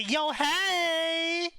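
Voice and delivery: high, drawn-out "hey" in a mock holler; hammed-up high-pitched yelled greeting; playful yell. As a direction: call it out high and stretch the greeting. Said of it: "she yelled like".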